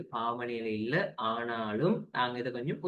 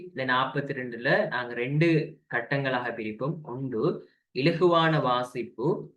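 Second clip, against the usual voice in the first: usual speaking voice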